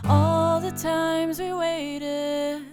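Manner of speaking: ordinary delivery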